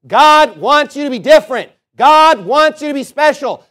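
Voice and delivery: loud, ringing pulpit preaching; booming sermon delivery, building to the exception. Acted. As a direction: preach it out to the whole room. Preaches at full force.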